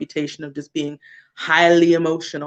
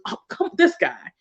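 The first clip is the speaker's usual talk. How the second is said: much higher, exasperated little scoff at another driver; mild eye-roll annoyance; 11 semitones higher, 3 dB quieter than usual. Retold or imitated. Chilled-out driver cut off in traffic, mild exasperated scoff. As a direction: roll your eyes at the guy who cut you off.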